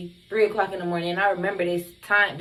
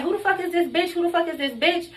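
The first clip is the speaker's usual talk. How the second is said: retold outrage; 7 semitones higher than usual. Demanding who is at the door, retold outrage. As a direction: act out an indignant demand, voice pitched way up.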